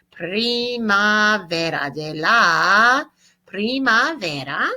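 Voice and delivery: primavera stretched out syllable by syllable; slow model phrase. Shown as a demonstration. Teacher models spring in Spanish for students, slow and drawn out. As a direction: say de la primavera very slowly, each sound held.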